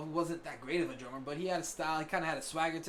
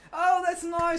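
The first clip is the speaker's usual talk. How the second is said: patronizing parent; 12 semitones higher, 7 dB louder, much slower than usual. Retold or imitated. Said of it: "mocking praise for [name]".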